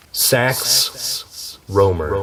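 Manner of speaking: slow, grand, savoring the name; dramatic slow reveal. Pretend. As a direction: announce it like a grand title. Mock grand choice of pen name.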